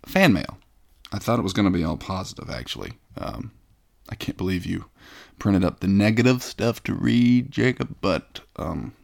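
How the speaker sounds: ordinary delivery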